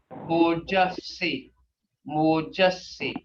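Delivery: Mojasi said slowly and carefully; slow sounded-out Muskogee word. Shown as a demonstration. Teacher modelling Mojasi for the students. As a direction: draw out Mojasi syllable by syllable. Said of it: "Hear that?".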